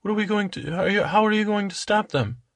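high, anxious princess; worried princess voice. Acted. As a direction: ask it high like a fretting princess. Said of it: "And Cinderella".

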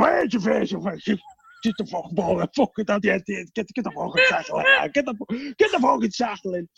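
mock broad Scouse shouting at kids; thick Scouse coaching. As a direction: bark like a Liverpool coach, hammed-up. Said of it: "And I'm going".

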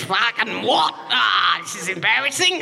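comic indignant yell; mock outraged shout. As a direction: shout it at him like you can't believe it. Retold retort to Greg.

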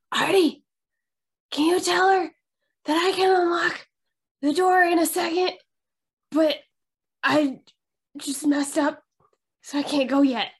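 loud urgent whisper-shout. Acted. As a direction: call out urgently under your breath. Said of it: "I'm just gonna whisper shout".

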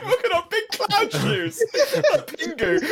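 giggling high while teasing; laughing. Real, not acted. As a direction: laugh through the words.

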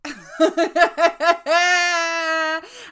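loud burst of laughter; big laugh. Real, not acted. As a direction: laugh out loud, high and bright.

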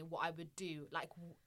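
ordinary talk, nothing marked

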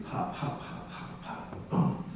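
hushed, whispery bouncing words; softly sketch each little hop as a sound effect; mimed hopping. Retold or imitated. Mimics the rescued sheep bouncing away.

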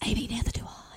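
breathy hushed whisper, almost no voice; whispered confession. Real, not acted. Whispering to Amy that she doesn't get it either. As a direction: let it slip out as a secret.

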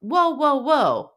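loud, urgent halting whoas; alarmed whoa. Retold or imitated. Wife stopping her husband's talk, with loud urgent whoas. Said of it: "So she says".

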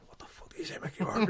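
hushed, breathless whisper rising on the name; excited whisper. Retold or imitated. Friends at the gym whisper in disbelief about Mickey Rourke, excited whisper. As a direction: whisper it like you can't believe who's downstairs.

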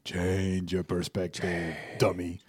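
low, soft teasing inner voice; a hint of a friend's mocking tone; Joaquin's voice. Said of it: "It was the voice of Joaquin".

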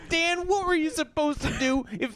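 teasing high-pitched question to Dan; mock disbelief. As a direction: ask Dan in mock astonishment.

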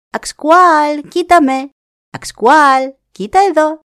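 high, loud calling; shouting. Acted. Raven shouting to the deer, high and loud. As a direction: shout across the valley to the deer. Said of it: "άρχισε να φωνάζει".

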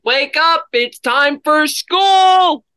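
high, slow yell to wake her; yelling wake-up call. Retold or imitated. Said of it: "I was yelling for thirty minutes".

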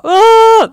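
high, loud drawn-out mock wail; breakdown wail. Mother breaks down as partner opens door, acted loud wail. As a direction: let out a long re-enacted cry of collapse. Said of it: "då bröt jag ihop helt".

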